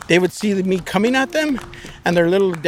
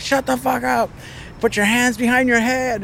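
retold police order; 7 semitones higher than usual. Officer ordering the suspect to surrender, high commanding yell. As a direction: order a suspect around in a raised pitch.

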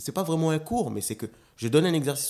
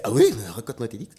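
mock feigned interest; 8 dB louder, faster than usual. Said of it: "Il a fait".

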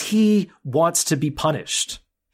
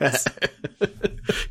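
breathy laugh; 4 dB quieter than usual. Real, not acted. Laugh after imitation.

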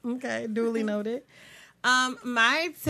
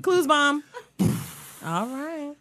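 ordinary talk, nothing marked

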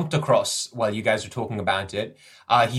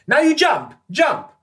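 high, loud shouted command; calling out; 7 dB louder than usual. Acted. Guide calls back down the hallway to the party, high shout. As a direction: yell it urgently across a distance.

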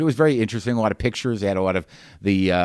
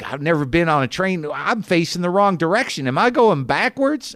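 higher-pitched imitation of an excited woman; mock excitement, climbing higher to the question; excited passenger; 9 semitones higher, 3 dB louder than usual. Woman marvelling at her first train ride, mock excited. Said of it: "They're just so excited".

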